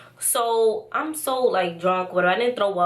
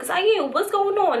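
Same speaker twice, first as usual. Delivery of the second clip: high, drawn-out mock plea; insistent questioning; 10 semitones higher, 3 dB louder, slower than usual. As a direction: press him for answers, voice pitched up. Questioning boyfriend, acted.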